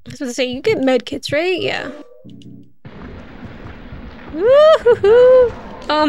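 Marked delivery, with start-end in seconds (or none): 4.3-5.5 high, loud, slow ghostly hoot; spooky woo. Pretend.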